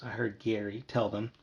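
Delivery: plain reading voice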